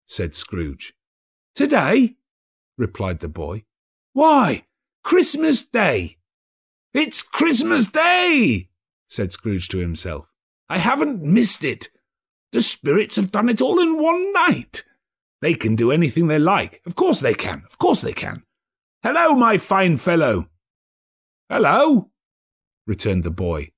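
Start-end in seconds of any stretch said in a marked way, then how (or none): 1.6-6.2 put-on high, loud boy's voice; surprised lad answering up; boy astonished at the question; street boy. 6.9-20.5 put-on old man rejoicing, then hailing the boy; giddy old man; overjoyed Scrooge. Scrooge voiced. 21.5-22.1 put-on high, loud boy's reply; boy calling back up; cheeky lad; street boy. Boy voiced.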